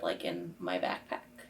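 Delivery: ordinary talk, nothing marked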